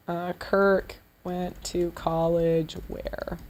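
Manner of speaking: ordinary delivery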